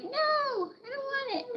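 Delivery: very high, slow squeaky chick voice; voicing the chick. Owner voicing her chick refusing medicine, very high squeak. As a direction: speak for the stubborn chick refusing. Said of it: "She closes her eye like".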